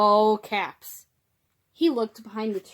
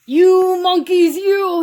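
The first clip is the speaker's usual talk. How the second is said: loud, drawn-out, high scolding; 9 semitones higher, 14 dB louder, slower than usual. A narrator's reading. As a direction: call out a slow, high telling-off. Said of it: "shaking a finger at them".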